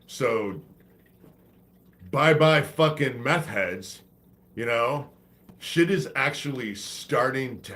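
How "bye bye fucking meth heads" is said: ordinary talk, nothing marked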